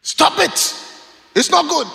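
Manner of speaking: high, forceful telling-off; blunt, hot-tempered. Retold or imitated. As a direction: cut them off sharply, straight to the face. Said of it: "he would tell you in the face".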